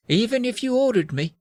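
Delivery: high but softened wizard voice; sorrowful wizard. Acted. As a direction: echo it with mock-tragic resignation. Sad wizard repeats the question.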